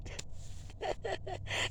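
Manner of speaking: soft airy laugh; breathy laugh. Real, not acted.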